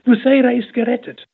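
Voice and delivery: high, loud jubilant news; joyful shout. A narrator's reading. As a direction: shout the good news with joy. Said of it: "rief die Schwester Katharina".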